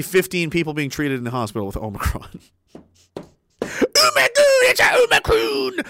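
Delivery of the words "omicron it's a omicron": very high mock wail; hammed-up hysteria. Host mocks Omicron panic, high shrieking wail. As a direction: shriek it in fake terror.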